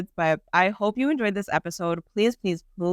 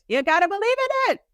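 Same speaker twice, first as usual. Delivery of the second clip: excited cry. Real, not acted. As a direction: cry it out to the crowd, suddenly higher. Urging listeners, peak of rant.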